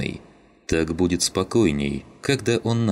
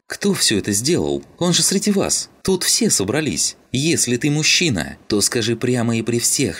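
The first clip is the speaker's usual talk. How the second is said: high, insistent, indignant demand; heated challenge; 7 semitones higher, 3 dB louder than usual. Acted. Родька demands that the culprit confess before everyone, heated.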